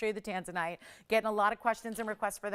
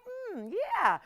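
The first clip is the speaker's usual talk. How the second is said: unimpressed; 10 semitones higher than usual. Retold or imitated. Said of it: "it's still like".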